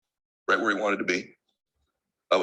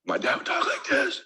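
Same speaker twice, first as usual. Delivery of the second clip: weak, airless whisper of a sick father; faint whispered imitation of dad; imitating dad's failing voice. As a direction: breathe it out like a dad with no voice left. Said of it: "I'm gonna do it in my voice".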